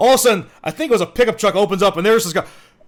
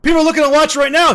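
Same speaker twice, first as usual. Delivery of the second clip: hyped up; 7 semitones higher than usual. Acted. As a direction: pump up the room. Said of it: "let's take it down a notch".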